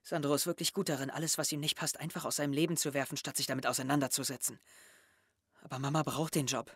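soft, low and downcast; boy hurt by friend's message, softly; dejected. Acted. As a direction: let the hurt sink in quietly.

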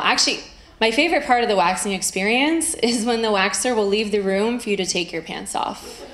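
ordinary delivery

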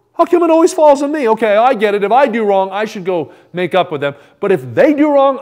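high, loud whining protest; hammed-up aggrieved complaint; indignant objector. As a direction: protest the unfairness loudly. Voices objector protesting unfairness.

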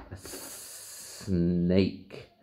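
ordinary delivery, nothing marked

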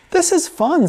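high, bright delight; a small boy loving it; delighted kid. Acted. Little boy enjoying the mess, delighted.

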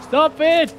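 loud plea. Real, not acted. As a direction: raise your voice pleading stop it. Repeated louder plea.

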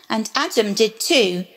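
ordinary delivery, nothing marked

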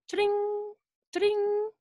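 high ringing "cha-ding"; bicycle bell. Shown as a demonstration. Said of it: "A bicycle bell ringing".

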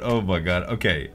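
ordinary talk, nothing marked

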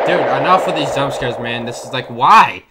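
loud, rattled complaint; exasperated outburst. Real, not acted. Player fed up with jump scares, loud exasperation. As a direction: protest loudly after a fright.

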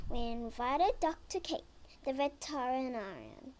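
usual speaking voice

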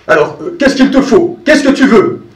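worried, trying to please; anxious old farmer. Acted.

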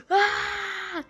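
high shocked scream; startled joyful cry; real high scream of shock. Real, not acted. Pack reveal.

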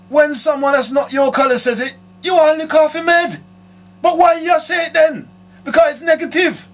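high, loud, fired-up protest; getting worked up about it; heated rant. Real, not acted. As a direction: voice rising with indignation.

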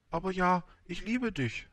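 high-pitched flower confession; high storybook flower voice; proud flower, loving. A narrator's reading. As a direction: declare love in a high character voice.

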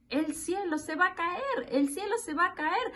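high-pitched hint of clucking hens; lightly voiced cackling hens. A narrator's reading. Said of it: "cacarean las gallinas". (leve cacareo de gallinas, voz aguda)

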